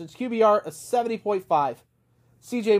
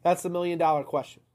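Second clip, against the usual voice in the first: normal talking voice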